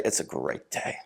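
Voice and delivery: hushed whisper, almost no voice; soft breathy whisper of the same line. Shown as a demonstration. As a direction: whisper it as if sharing a secret. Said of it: "I said two very different things".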